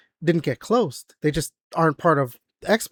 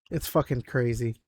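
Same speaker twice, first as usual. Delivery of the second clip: normal talking voice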